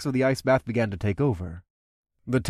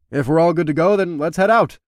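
calling out; 5 semitones higher, 6 dB louder than usual. Acted. Leader calling crew.

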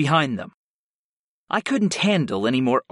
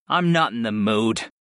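plain reading voice

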